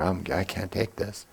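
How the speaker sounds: soft, slow feeble whimper of a squeamish husband. Retold or imitated. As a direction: go weak and faint-hearted. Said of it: "they're like".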